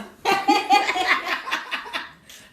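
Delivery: long high laugh; laughter. Real, not acted. Laughs at the marriage remark. (long éclat de rire aigu)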